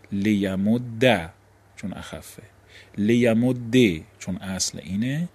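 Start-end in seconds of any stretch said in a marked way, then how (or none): 0.1-1.3 «لیمد» ending in a doubled d and short "a"; final fatha vowel. Shown as a demonstration. Teacher showing the first of three forms, «لیمد» ending in -dda. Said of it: "حرکت عارضی بهش بدیم". 3.0-3.7 «لیمد» ending in a doubled d and "e"; final kasra vowel. Shown as a demonstration. Teacher showing the second form, «لیمد» ending in -dde. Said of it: "گفتیم سه حالت داره".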